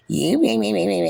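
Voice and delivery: high sing-song mimicry; mocking babble. Calling the meeting bullshit, high mocking babble.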